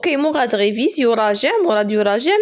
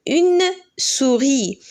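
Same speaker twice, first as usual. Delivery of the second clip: slow model word; 3 semitones higher, much slower than usual. Shown as a demonstration. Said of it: "teacher models word slowly".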